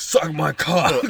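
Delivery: loud, drawn-out mock command; commanding puberty voice. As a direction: bellow it slowly, on the edge of laughing. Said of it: "my voice was so commanding".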